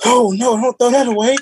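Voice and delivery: imitating the neighbor. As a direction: play the neighbor stopping you. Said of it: "He's like".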